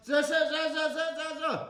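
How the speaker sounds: loud, high string of buzzes; imitated buzzing. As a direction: rattle off sharp buzzing bursts. Imitated noise during sums lesson.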